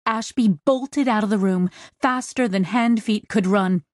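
ordinary delivery

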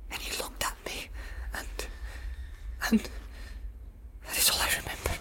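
shaken, stammering whisper; frightened stammer. Acted. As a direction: stammer it out in terror. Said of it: "stammered Pippin".

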